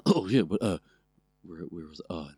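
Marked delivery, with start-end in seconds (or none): none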